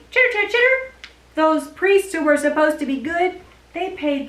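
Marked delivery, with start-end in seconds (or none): 0.1-0.9 long run of high squeaky chitters; chittering puppet. Pretend. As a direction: chatter on and on like a critter puppet. Puppet reacts.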